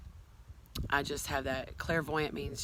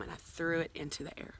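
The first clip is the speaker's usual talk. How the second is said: ordinary delivery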